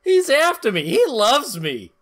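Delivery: mock-swooning villain. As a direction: tease by repeating his boast. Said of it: "mocking repeat of Rip's line".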